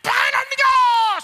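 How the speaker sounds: high, drawn-out full-force yell; imitated shout. King yells at priests who try stopping him, loud high shout. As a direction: shout it out slowly, acting the outburst.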